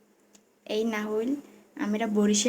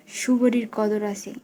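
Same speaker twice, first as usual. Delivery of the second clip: ordinary talk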